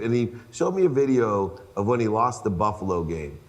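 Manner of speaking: usual speaking voice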